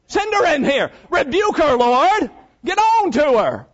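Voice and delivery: high, pushy demand for her sister to be scolded; Martha demanding. Acted. Martha demanding the Lord scold Mary, pitched high. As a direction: insist the Lord rebuke her. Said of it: "She said".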